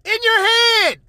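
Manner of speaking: high, loud ghostly taunt; spirit voice. Pretend. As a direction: shout from inside their head. Voicing a spirit.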